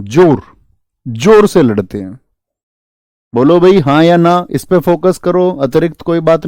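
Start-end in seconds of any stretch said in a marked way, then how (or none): 0.0-2.2 "जोर" punched twice as the key word; stress on जोर. Shown as a demonstration. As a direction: repeat "जोर" and lean on it, rising at the end. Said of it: "mnemonic, word repeated". ("जोर" पर ज़ोर देकर, दोहराते हुए)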